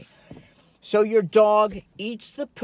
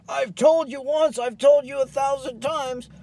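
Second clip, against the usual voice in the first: higher and louder, fed-up owner scolding the dog; exasperated scolding; 7 semitones higher, 3 dB louder than usual. Retold or imitated. Exhausted owner scolding the dog, exasperated and louder. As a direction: scold like you've said it a thousand times. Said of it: "You're all, you're exhausted".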